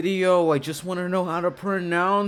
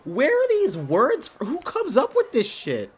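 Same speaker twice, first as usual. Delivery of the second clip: incredulous outburst; 8 semitones higher, 5 dB louder than usual. Real, not acted. Baffled outburst at game text.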